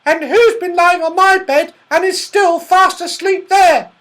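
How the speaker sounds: very high, loud squeal of a little bear; Baby Bear. A narrator's reading. Baby Bear finds a sleeper in the cot, squealing. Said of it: "said the Baby Bear".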